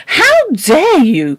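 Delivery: high, loud, slow outraged cry; shaking with anger. Acted. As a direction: let the fury shake every word. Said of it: "cried Missus Biddle ... voice shook with angriness".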